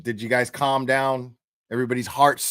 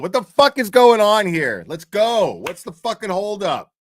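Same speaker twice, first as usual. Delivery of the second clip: higher, louder impatient grumble, mock; impatient Aiden; 8 semitones higher, 6 dB louder than usual. Streamer imitating impatient Aiden waiting, mock impatient higher voice. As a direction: hurry everyone along, half-joking. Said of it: "he's sitting there going".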